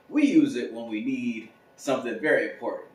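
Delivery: usual speaking voice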